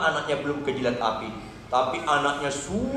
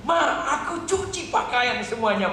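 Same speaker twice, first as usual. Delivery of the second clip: higher, willing daughter; eager obedience; 9 semitones higher than usual. Acted. Daughter agrees to wash her mother's clothes, eager. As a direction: answer readily with raised pitch.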